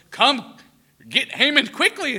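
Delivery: loud, high, eager call to fetch Haman; the king excitedly summons Haman, loud, high. Retold or imitated. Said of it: "the king excitedly goes".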